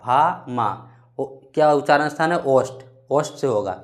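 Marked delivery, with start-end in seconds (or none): none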